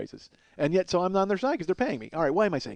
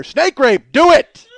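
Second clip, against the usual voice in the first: higher, louder cheer rising to a yell; excited shout; 12 semitones higher, 14 dB louder, much slower than usual. Pretend. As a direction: cheer them on from a lawn chair. Throwing money, cheering on.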